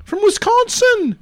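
high British character voice; British, pitched well up; British accent. Acted. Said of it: "I'm doing it in a British accent".

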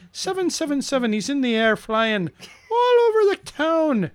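climbing high, playful proclamation; hammed-up announcer. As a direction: let the voice soar up high. Hamming up the milestone announcement.

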